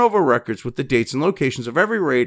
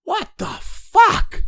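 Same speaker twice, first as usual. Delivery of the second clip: stunned, stretched-out disbelief, pitched much higher; incredulous; 14 semitones higher, much slower than usual. Real, not acted. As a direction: draw it out in shock. Disbelief at news clip.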